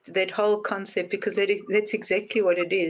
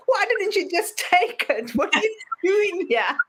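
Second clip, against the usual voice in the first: much higher, half-laughing mock shout; exasperated God; 8 semitones higher than usual. God scolding drowned man, high half-laughing yell. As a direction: throw up your hands at him.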